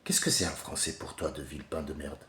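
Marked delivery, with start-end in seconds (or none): none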